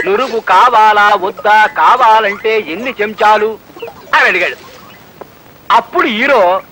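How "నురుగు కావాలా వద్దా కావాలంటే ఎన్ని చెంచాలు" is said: drawn-out menu recital. Retold or imitated. Server listing kinds of coffee.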